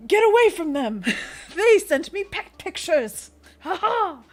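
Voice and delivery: a loud, high heroic battle cry with laughter; heroic rescuer. Pretend. A pet-loving hero shouts at an attacker, triumphant. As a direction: burst in as a triumphant saviour. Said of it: "I show up out of the shadows and I'm like".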